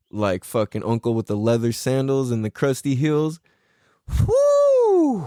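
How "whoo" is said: long high whoo, falling off; piercing, drawn-out whoo; excited whoop. Real, not acted. Reacting to crusty-heeled uncle.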